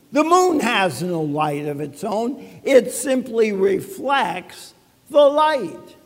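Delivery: ordinary delivery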